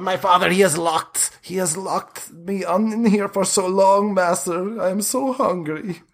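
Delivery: high, pleading and wretched; a starving vampire begs his master for release; starved pleading vampire. Acted. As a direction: beg like a starving creature locked away.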